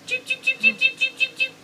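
very high chirping cheeps; high bird cheeps. Pretend. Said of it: "a birdie".

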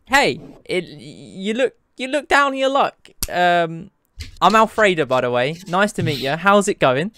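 chirpy barmaid greeting a stranger. Acted. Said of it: "the barmaid turns to you and goes".

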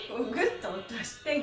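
ordinary delivery

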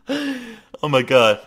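giggling through the words; delighted laugh. Real, not acted. Laughs at the arriving character.

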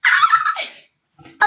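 a sudden very high shriek; high startled shriek. Pretend. Doll startled while reading, high shriek. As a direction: yelp like something jumped out.

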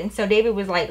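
usual speaking voice

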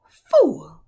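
loud, high savage snarl; snarling witch. A narrator's reading. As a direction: spit the word in contempt. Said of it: "a savage smile ... almost a snarl".

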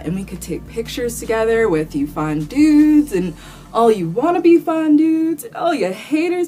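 ordinary talk, nothing marked

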